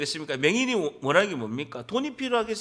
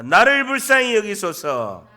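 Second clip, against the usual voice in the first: loud, slow plea to be repeated; preacher leading a shouted prayer; crying out; 9 dB louder, slower than usual. Shown as a demonstration. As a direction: call out for mercy at full voice, drawing it out. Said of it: "그렇게 외치는 거예요".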